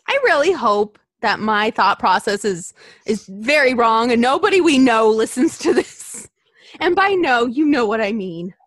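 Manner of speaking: embarrassed outcry. Real, not acted. Embarrassed outburst while reading smut.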